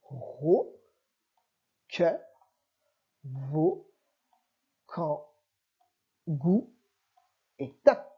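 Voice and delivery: each syllable read alone, clearly spaced; lecture de syllabes. Shown as a demonstration. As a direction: model each syllable slowly for a beginner reader. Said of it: "Deuxième ligne". (syllabes lues lentement, une à une)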